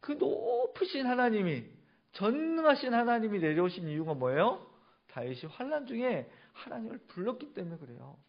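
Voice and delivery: high, loud sermon climax; impassioned preaching. Real, not acted. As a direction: proclaim it forcefully to the whole congregation. Preaches the climax at full force.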